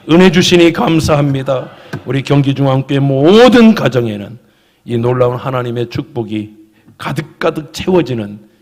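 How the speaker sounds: loud, fervent pastoral prayer. Real, not acted. Pastor praying for the congregation's families, loud and fervent. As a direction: pray it out strongly over the whole church.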